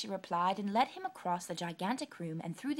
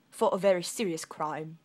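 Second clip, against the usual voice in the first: stern soldier; 9 dB louder than usual. Acted. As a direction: pronounce it gravely. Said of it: "replied the soldier".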